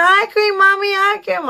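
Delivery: put-on high-pitched little-kid pleading; mimicked begging child. Son begging mommy for ice cream, mimicked pleading. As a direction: imitate a small child pestering a parent.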